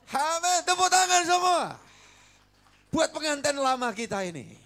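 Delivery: exultant call for applause. Real, not acted. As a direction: rouse the church to clap. Calling congregation to applaud.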